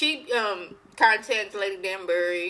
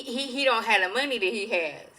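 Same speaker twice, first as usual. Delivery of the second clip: ordinary delivery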